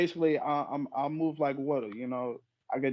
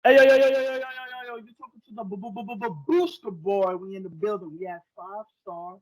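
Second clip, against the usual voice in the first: wrestling promo; 5 semitones higher than usual. Acted. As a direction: cut a high-energy wrestler promo. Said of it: "I wanna challenge you to do a promo in character".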